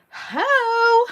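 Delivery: high, loud mock hello; a teasing whoop of a greeting; joking shouted greeting. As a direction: blurt it out big and high. Jokingly echoes viewer's mistyped greeting.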